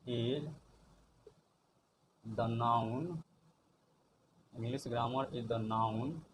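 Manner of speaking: each word spoken softly and slowly, with long pauses; slow dictation. Shown as a demonstration. Teacher dictates the English definition slowly to the class. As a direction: drop the definition word by word for students to copy.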